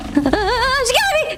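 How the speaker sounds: slow laugh-cry squeal. Real, not acted. Streamer laughing as an enemy grabs her. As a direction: laugh it out, high and stretched.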